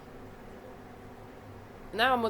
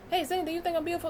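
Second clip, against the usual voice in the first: mocking vain woman; 10 semitones higher, much faster than usual.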